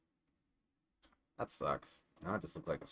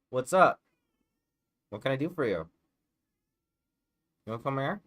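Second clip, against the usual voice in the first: high sweet pet voice; 7 semitones higher, 9 dB louder, faster than usual. Real, not acted. Coaxing her cat over in sing-song baby talk.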